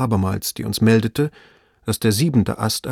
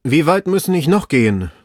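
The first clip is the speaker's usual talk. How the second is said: raised, louder call from afar; 6 semitones higher, 5 dB louder than usual. A narrator's reading. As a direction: call down from up high. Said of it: "hörte man seine Stimme rufen".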